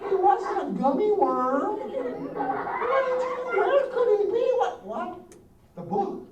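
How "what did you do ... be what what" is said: mock alarm. Pretending the caterpillar vanished.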